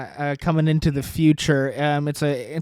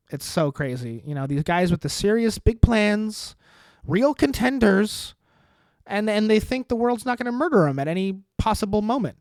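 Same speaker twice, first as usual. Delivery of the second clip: ordinary talk, nothing marked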